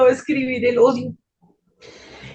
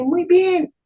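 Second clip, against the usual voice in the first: beautiful voice; 6 semitones higher than usual. Retold or imitated. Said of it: "esa voz hermosa me dice".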